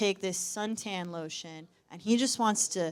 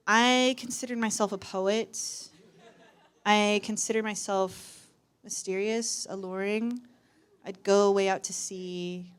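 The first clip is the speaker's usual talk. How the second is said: ordinary talk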